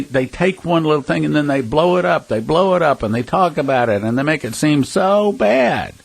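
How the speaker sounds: usual speaking voice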